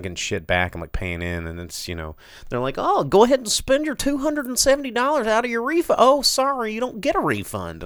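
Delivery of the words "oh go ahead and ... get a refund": mocking voice. Mocking the tax people.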